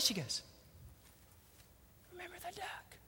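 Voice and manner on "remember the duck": whispered blackmail; menacing whisper. Acted. Sister reminds Johnny of his secret, menacing whisper. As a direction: hush it as a secret threat.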